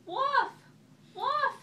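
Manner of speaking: very high loud alarm shout. A narrator's reading. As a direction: scream wolf as a warning. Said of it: "he cried".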